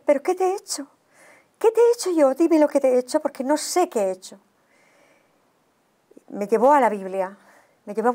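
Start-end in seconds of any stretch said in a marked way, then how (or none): 0.0-4.4 high, pleading, near tears; tearful plea. Retold or imitated. Pleading tearfully with an angry Jesus at night. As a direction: beg to know what you did wrong, choked up. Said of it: "yo me puse a llorar y decía".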